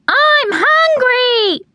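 high, drawn-out piercing squeal; hungry chick scream. A narrator's reading. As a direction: scream it like a baby bird begging for food. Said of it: "they would scream".

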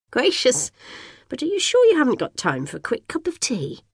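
put-on high, fluttery old lady voice; fussy neighbour. A narrator's reading. Old neighbour greeting the twins in a high, gushing voice. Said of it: "says Missus Dunkerley".

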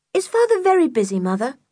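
put-on high, quick girlish question; eager child asking. A narrator's reading. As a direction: ask mother eagerly, pitched high. Girl asks her mother in story.

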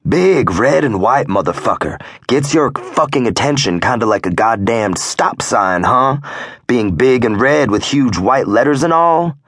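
high, mocking sarcasm with rising disbelief; sarcastic. A narrator's reading. Club member mocking the prospect about the door sign, sarcastic. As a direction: lay the sarcasm on thick, pitched well up. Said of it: "I asked in a sarcastic tone".

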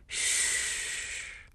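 soft breathy shush into her ear; whispered hush. A narrator's reading. A ghostly voice calming her, whispered shush. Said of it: "a voice murmured".

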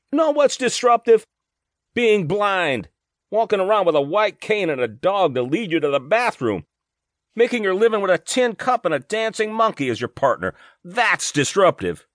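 angry yelling. A narrator's reading. Wife rants at the official about blindness, high and angry. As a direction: lash him with the picture of blindness. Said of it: "she yelled".

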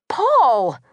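exasperated. A narrator's reading. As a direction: cut off the joking with a sharp rebuke. Sister exasperated by brother's joking.

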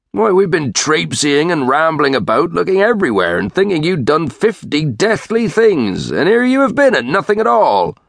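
high, rattling rustic scolding; worked-up countryman telling off the stray; exasperated searcher. Acted. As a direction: pour out the complaint, climbing to an indignant peak. Search party scolds Dick.

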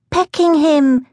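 put-on high small-child voice; high-pitched childish dismay; distressed little boy. A narrator's reading. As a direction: fret like an upset toddler.

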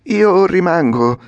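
the puppet digging in his heels; Pinocchio, firm. Acted. Pinocchio answers the coachman. (Pinocchio deciso, voce alta e lenta)

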